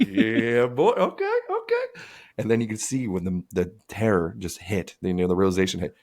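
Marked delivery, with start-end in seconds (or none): 0.1-2.0 slow, very high gleeful okay; delighted. Retold or imitated. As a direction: savour it in a squeal of delight. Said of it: "He's like".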